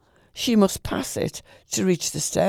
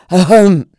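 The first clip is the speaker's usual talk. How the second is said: a loud, pointed throat-clearing ahem; 13 dB louder than usual. A narrator's reading. Pickwick behind the curtains announces himself, loud ahem. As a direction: clear the throat loudly to get noticed. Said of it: "called out very loudly".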